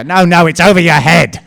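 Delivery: loud, high call pointing upward. Retold or imitated. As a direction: shout it so they finally look up. Calling to people looking wrong way.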